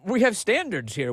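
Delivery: high prissy newscaster parody; mock anchor. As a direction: sneer it in a prim high voice. Mocking the news anchor.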